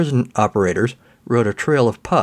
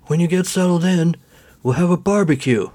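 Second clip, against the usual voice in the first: put-on raised, higher old man call; 7 semitones higher than usual. A narrator's reading. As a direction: call back like a cheerful old neighbor. Old man calls over shoulder.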